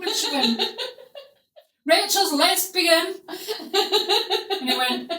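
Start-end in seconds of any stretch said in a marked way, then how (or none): 1.8-3.1 loud, laughing mock announcement; imitated grandma shouting the news; calling out. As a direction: holler it like big news.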